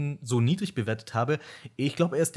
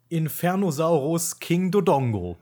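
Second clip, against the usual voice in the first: dramatic announcement; 4 semitones higher, 4 dB louder, slower than usual. Acted. Countdown reveal of place ten.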